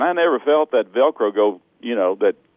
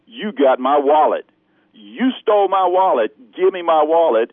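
raised, higher pitched accusing shout; retold angry yelling; 5 semitones higher than usual. Tourist confronting the pickpocket girl, re-enacting his yelling. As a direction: demand the wallet back, voice climbing. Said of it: "started screaming in her face".

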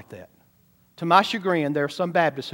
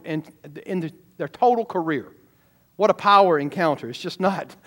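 usual speaking voice

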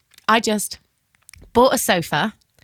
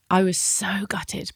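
whispered; 3 dB quieter, slower than usual. Real, not acted.

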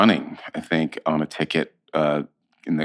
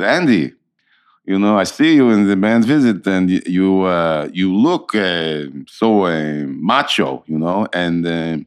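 put-on blunt Israeli guy, Israeli accent, unhurried; 3 dB louder than usual. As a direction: deliver the verdict in an Israeli accent. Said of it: "He said".

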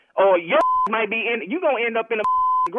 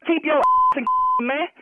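heated, raised-pitch threat; angry warning; 4 semitones higher than usual. Real, not acted. Husband warns caller off his wife, angry. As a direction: warn him off, worked up. Real anger at prank caller.

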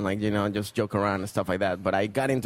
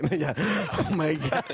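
higher-pitched, talking through laughter; real helpless amusement; laughing; 4 semitones higher, slower than usual. Real, not acted. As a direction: crack up while agreeing. Cracking up at the bit.

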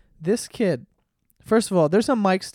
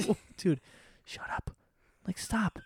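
retold hushed plea to stop; 8 dB quieter than usual. Begging drunk friend to be quiet at night, hushed. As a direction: keep it under your breath. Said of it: "trying not to wake my mom".

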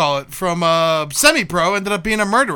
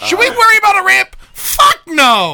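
offended voice; 9 semitones higher, 4 dB louder than usual. Pretend. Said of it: "I just decided to make my offended voice".

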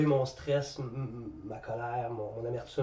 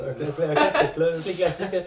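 laughing; 3 semitones higher, 6 dB louder than usual. Real, not acted. Laughing at own embarrassing answer.

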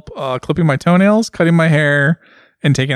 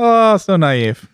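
mock pity; 6 semitones higher, much slower than usual. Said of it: "mocking naive stepsister".

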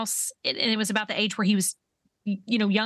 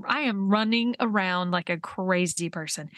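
ordinary talk

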